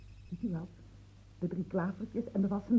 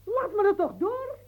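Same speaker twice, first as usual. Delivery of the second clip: crying out; 10 semitones higher than usual. Acted. Pushing past the servant.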